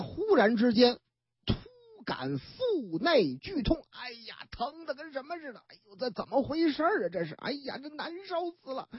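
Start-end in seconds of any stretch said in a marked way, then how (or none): 3.9-8.9 soft, strained moans of pain; pained groaning. Retold or imitated. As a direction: play a stomach cramp, groaning under the breath. 王姑娘突感腹痛. (压低声音痛苦地呻吟)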